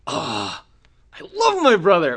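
high, loud swoon over a brother; gushing affection. Acted. Doting sibling asked about a brother, gushing affection. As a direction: gush it with a drawn-out "love". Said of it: "Do you love them? Like, are you like".

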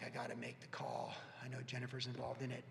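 whispered thought. Retold or imitated. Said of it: "I'm like".

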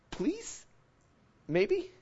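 tiny high, soft questioning voice; acted doubtful little squeak; tentative plea. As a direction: ask timidly, barely daring to hope. Acting out a doubtful prayer.

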